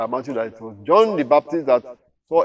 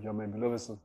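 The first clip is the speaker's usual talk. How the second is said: quiet aside; 2 semitones lower, 12 dB quieter, faster than usual. Shown as a demonstration. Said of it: "as if he's saying to Jesus".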